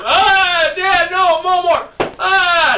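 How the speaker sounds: high, drawn-out fake wail; pretend hollering. Boy pleading with dad during a staged spanking, mock hollering. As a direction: plead in a high mock cry, faking pain. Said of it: "I'd be hollering".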